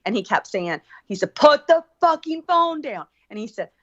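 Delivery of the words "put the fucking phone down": mock yell, higher and louder; retold cop scream. Cop yelling at a man filming the stop, mock shout. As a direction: bark it like the cop with his gun drawn. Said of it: "he was screaming".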